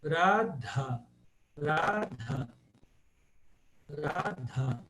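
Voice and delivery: "Radha" split in two, with an aspirated DH; pronounced H. Shown as a demonstration. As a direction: say "Radha" slowly, breathing out the H after the D. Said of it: "esse H é pronunciado". ("Radha" com o H aspirado)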